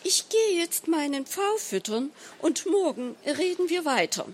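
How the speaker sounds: high, sweetly indulgent wife; mildly smiling. A narrator's reading. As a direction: say it with a mild smile, pitch lifted. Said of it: "dann lächelte sie milde".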